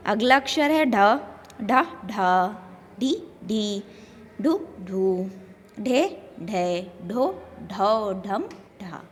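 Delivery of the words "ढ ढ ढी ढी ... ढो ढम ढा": softer drill, first "ढी" brief, second held; syllable drill. Shown as a demonstration. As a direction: recite aspirated "ढ, ढी, ढू" in short-long pairs. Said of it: "व्यंजन अक्षरों का उच्चारण किस तरह".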